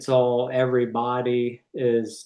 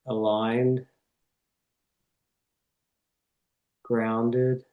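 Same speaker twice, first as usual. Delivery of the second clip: reverent prayer; 2 semitones lower, 4 dB quieter than usual. Real, not acted. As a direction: pray unhurriedly, phrase by phrase, settling into a hush. Leads an opening prayer.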